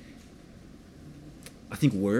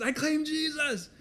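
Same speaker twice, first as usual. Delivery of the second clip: laughing retold declaration; 14 semitones higher, 4 dB quieter than usual. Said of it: "And I said".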